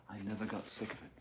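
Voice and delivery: hushed but still voiced; quiet confession. Real, not acted. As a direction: say it softly and quickly. First saying, then whispered repeat.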